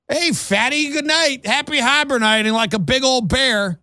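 high, teasing smart speaker voice; mock insulting assistant. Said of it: "did my Google Home just call me fat?".

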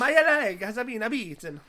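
slow, hammed-up Italian accent; moaning about a leg in a cartoon Italian accent; a comic Italian accent, drawn out; mock Italian accent. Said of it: "You're doing it".

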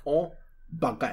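French accent. Shown as a demonstration. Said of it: "it's very simple. I'll teach you".

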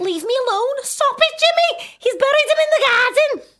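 very high, loud mock-hysterical Scouse; Brookside soap impression. Guest re-enacting Brookside scene, hammed-up shrieking. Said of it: "remember Brookside?".

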